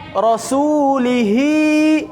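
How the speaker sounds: rasulihi, slow, the final hi drawn out; long hi. Shown as a demonstration. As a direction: stretch the hi in rasulihi. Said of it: "hi-nya panjang".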